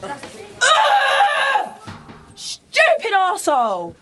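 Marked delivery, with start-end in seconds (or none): 0.0-1.7 frustrated groan. Real, not acted. Called to the diary room again, frustrated ugh. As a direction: let out a fed-up groan.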